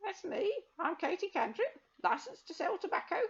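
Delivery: put-on high, cackly old crone; proud old shopkeeper witch. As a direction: pitch it up as a sly old woman.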